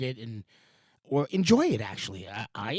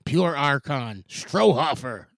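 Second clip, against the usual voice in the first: normal talking voice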